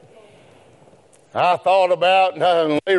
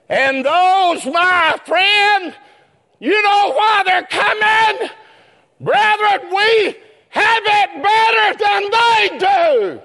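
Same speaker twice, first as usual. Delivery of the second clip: preaching at full force; 12 semitones higher, slower than usual. Acted. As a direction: ring it out like a revival preacher. Sermon climax, shouting brethren.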